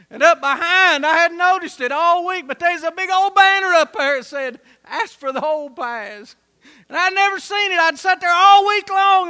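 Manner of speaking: fired-up country preacher; preaching at full force. Acted. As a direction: build it up like a revival preacher.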